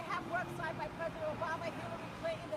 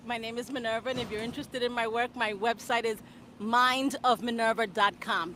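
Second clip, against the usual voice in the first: much louder, slow and deliberate into the mic; right into the mic; 6 semitones lower, 11 dB louder, slower than usual. Real, not acted. Artist told to speak into the mic, plugging her site loudly. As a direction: lean in and announce it clearly. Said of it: "talk in the mic right here".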